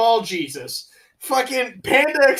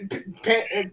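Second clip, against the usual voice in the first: usual speaking voice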